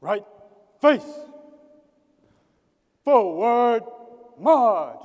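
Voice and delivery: loud drawn out drill command; drawn out parade commands. Real, not acted. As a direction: bark it to the whole squad. Color guard ceremony commands.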